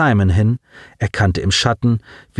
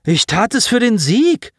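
justifying himself; 12 semitones higher than usual. Acted. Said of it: "rechtfertigte er sich".

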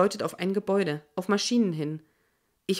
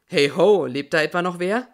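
a loud call-out from a radio man; man answering; 6 dB louder than usual. Acted. Radio man wonders if someone is alive, loud casual call. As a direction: call out with mild surprise. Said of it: "Und eine Männerstimme antwortet".

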